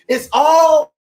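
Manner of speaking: shouted high and loud preacher emphasis. Real, not acted. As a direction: belt it out to the congregation.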